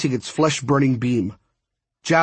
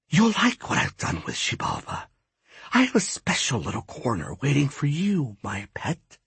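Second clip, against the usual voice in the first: soft, swooping menace, sinking at the end; gloating goddess; 5 dB quieter than usual. A narrator's reading. Ishtab to Apuch, softly gloating. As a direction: purr a threat, trailing down to a hush.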